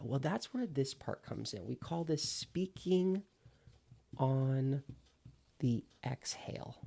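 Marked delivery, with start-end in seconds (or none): 2.3-6.8 slow emphasis. Shown as a demonstration. Coach slowly names the technique.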